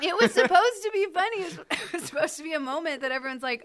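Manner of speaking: high-pitched, laughing insistence. Real, not acted. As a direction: protest through laughter.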